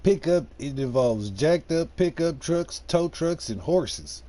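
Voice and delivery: hammed-up good ol' boy twang, stretched out. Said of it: "In my redneck voice".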